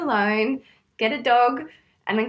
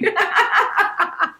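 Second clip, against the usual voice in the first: laughing; 9 semitones higher, 6 dB louder than usual. Real, not acted. Laughs at own joke.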